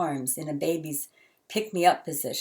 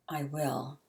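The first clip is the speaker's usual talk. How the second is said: choked up; 7 dB quieter than usual. A narrator's reading. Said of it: "from a throat that contained the stone".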